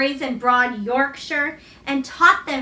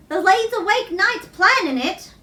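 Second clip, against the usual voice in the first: lightly voiced Yorkshire mother, broad and kindly; motherly Yorkshire woman; 6 semitones higher than usual. A narrator's reading. Susan Sowerby reassures Colin about magic, lightly voiced Yorkshire mother. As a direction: give the old countrywoman a gentle Yorkshire lilt.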